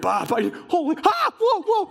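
mock shepherd startled mid-bleat, jumping high; awestruck shock. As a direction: act out stunned yelps at a sudden sight. Shepherd startled by choir of angels.

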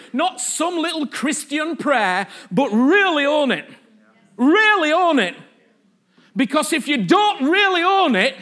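emphatic preacher push on really; preaching at full force. Real, not acted. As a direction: drive it home to the congregation. Preacher urging congregation.

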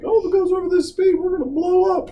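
high, fast mock panic; mock panicked passenger. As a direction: squeal it like a frantic passenger. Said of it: "It's like".

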